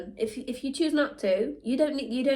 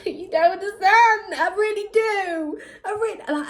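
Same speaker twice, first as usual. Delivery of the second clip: laughing protest; 9 semitones higher than usual. Real, not acted.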